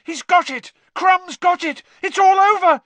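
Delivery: very high, loud yelling, building; thrilled spectator's cry; triumphant shout. A narrator's reading. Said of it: "shouted Harry".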